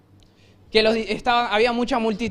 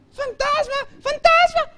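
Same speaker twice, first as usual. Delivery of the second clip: high, rising panicked shrieks, louder on the second; 5 dB louder, much slower than usual. Acted. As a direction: shriek in terror. Disciples panicking at ghost. (chillido de pánico, agudo y creciente)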